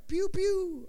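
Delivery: high-pitched "pew pew" gun sound; video game laser noise. Acted.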